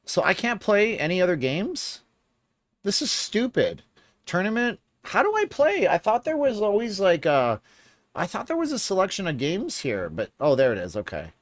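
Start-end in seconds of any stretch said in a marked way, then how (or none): 0.0-4.8 annoyed. Real, not acted. Frustrated with the game. 5.0-9.3 high, squeaky confused whine; baffled, pitch way up; exasperated. Real, not acted. Confused, can't find games.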